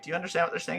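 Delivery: hushed confidential question; retold quiet whisper. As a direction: lean in and ask it under your breath. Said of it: "really quietly whispers".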